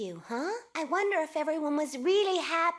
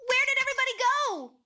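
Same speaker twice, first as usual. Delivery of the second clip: anxious calling out; 7 semitones higher than usual. Acted. Lime searching for vanished patients.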